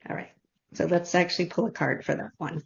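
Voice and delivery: ordinary talk, nothing marked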